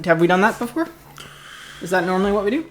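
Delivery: usual speaking voice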